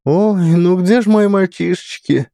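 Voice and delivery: put-on lightly bleating old woman; a touch of a bleat. A narrator's reading. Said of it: "Блеет воспитательница".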